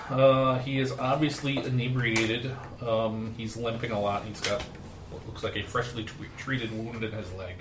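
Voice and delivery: ordinary talk, nothing marked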